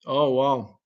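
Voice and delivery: ordinary talk, nothing marked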